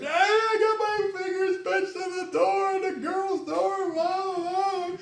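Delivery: high, drawn-out wail, mimicking a sobbing boy; mock crying child. Son runs to his dad with pinched fingers, mock crying. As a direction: imitate a crying kid, thin and high-pitched. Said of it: "he comes crying. He's like".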